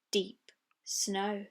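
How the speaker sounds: ordinary delivery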